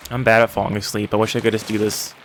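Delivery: usual speaking voice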